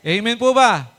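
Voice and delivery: loud, high call to the congregation; calling for amen. Real, not acted. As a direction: rouse the crowd for an answer. Preacher calls out to congregation. (malakas na tawag sa kongregasyon)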